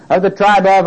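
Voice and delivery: "Of the tribe of" pitched high, then stopped short; emphatic lead-in. Shown as a demonstration. Preacher stopping the congregation before another word, emphatic. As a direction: raise "Of the tribe of" and halt before the name. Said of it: "I'm stopping again and emphasizing another word".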